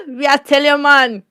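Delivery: ordinary talk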